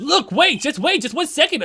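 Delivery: loud, high call to hold on; urgent shout. Acted. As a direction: stop everyone loudly. Urgent interruption.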